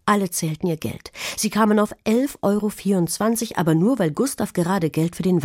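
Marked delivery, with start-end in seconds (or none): none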